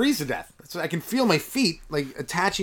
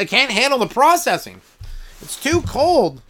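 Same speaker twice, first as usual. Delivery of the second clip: exasperated complaint; 7 semitones higher, 6 dB louder than usual. Real, not acted. Complaining frozen computer.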